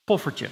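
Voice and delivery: poffertje said clearly as POF-fer-tje; Dutch word demo. Shown as a demonstration. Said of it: "the word".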